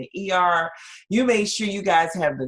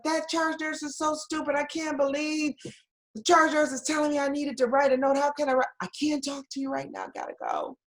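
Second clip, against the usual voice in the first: venting; 11 semitones higher than usual. Retold or imitated. Nurse venting to cousin by phone.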